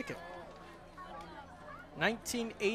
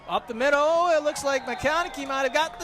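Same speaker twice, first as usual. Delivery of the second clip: higher and much louder play-by-play; excited touchdown call; 8 semitones higher, 9 dB louder than usual. Real, not acted. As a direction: call the touchdown with a rising roar. Touchdown call in live commentary.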